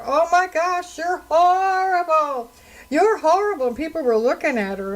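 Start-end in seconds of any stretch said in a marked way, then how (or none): 0.0-3.7 high-pitched mock scream, shocked and accusing. Woman screaming at me in the store for having no mask, high mock scream. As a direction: imitate her shrieking in outrage. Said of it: "she's screaming right in the middle of the store".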